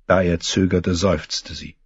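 ordinary delivery

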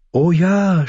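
high, teasing purr. Acted. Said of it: "schnurrte sie".

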